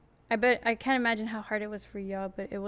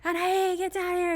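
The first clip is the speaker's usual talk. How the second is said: high, raised call for someone downstairs; mom yelling; 8 semitones higher, 5 dB louder than usual. Retold or imitated. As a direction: imitate a mother yelling for her kid. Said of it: "my mom starts yelling for me".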